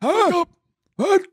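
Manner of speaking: high shouted wake up; mock scream. Comic yelling at a sleeping old man. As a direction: bark it at the dozing old fan. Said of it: "I was screaming at him".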